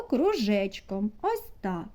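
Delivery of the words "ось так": ordinary talk, nothing marked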